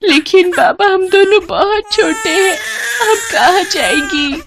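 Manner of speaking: high tearful begging; tearful plea. Acted. Girl begging her father not to throw them out, tearful. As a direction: plead in tears, voice high.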